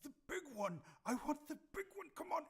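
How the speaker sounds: high, loud, impatient sword voice; Scalemar. A narrator's reading. Talking sword urging its wielder to attack, high eager pleading. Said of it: "you hear Scalemar in your head saying".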